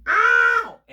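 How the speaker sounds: sharp high yelp of pain. Acted. Storyteller to kids, a sudden high ow of pain. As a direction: cry out as if hurt.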